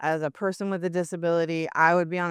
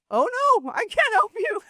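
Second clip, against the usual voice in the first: high-pitched, louder "oh no" to a panicker; retold dismay, pitched way up; mock alarmed refusal; 4 dB louder than usual. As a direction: back away with an exaggerated high voice. Retold reply to panicking swimmer.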